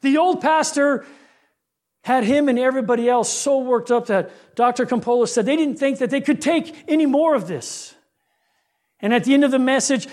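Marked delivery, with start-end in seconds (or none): none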